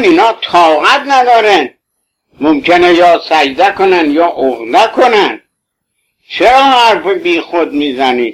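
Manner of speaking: usual speaking voice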